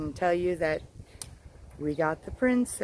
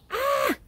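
short high squeal of joy; happy squeal. Real, not acted. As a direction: let out a high delighted squeal. Squeals over homegrown crops.

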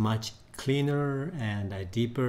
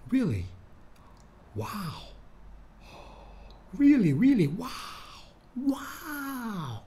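surprised; 11 semitones higher than usual. Shown as a demonstration. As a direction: sound stunned, voice leaping up. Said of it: "This is the surprise look".

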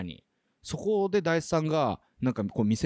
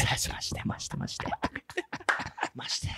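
playful whisper, soft and voiceless; hushed voice; 5 dB quieter, much faster than usual. Pretend. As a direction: whisper it over and over as a joke. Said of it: "小声で、".

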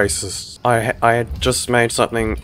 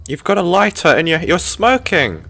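higher, exasperated disbelief; incredulous protest; 6 semitones higher, 4 dB louder than usual. Real, not acted. Player to smoking character who has a lighter, incredulous. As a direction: protest in high-pitched disbelief.

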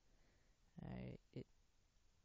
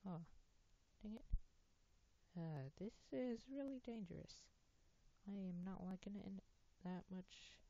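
raised, high-pitched put-on voice; 7 semitones higher than usual. Gamer on a dangerous spot, in a high put-on voice. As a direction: keep the voice pitched well up, unhurried. Still in high voice after voice attempt.

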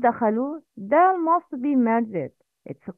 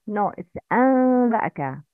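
usual speaking voice

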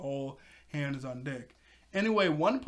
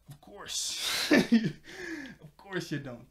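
sarcastic whisper; 4 semitones higher, slower than usual. Acted. Mocking the staff's excuse.

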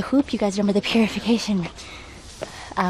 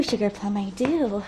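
ordinary talk, nothing marked

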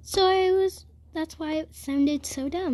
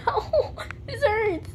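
high yelp of real pain; 9 semitones higher, slower than usual. Real, not acted. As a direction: cry out as it stabs. Stepping on Legos.

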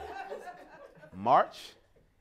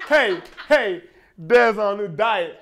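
mock teasing coworker; 3 dB louder than usual.

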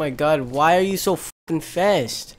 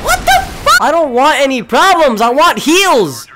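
higher, louder startled what-the-fuck; surprised outburst; 6 semitones higher, 8 dB louder than usual. Real, not acted. Gamer caught off guard begging for heals, startled loud. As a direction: jolt up in shock, then plead.

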